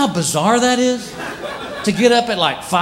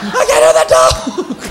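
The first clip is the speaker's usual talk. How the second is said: much higher, shouting, slow at the end; mock deaf panic; 12 semitones higher, much slower than usual. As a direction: yell like you've lost your hearing. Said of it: "You're like".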